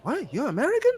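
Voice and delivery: high, incredulous mock voice of doubters; mock disbelief. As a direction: play the stunned skeptic, pitch jumping up. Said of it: "They're like".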